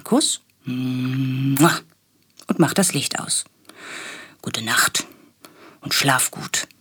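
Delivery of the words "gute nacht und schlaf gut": whispered goodnight. A narrator's reading. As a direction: hush it like a father leaving a sleepy room. Papa says goodnight.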